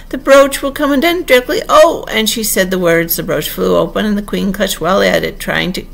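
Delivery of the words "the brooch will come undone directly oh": high, loud cry of distress; groaning wail. A narrator's reading. Queen foresees the brooch opening, wailing in distress. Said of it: "the poor Queen groaned out".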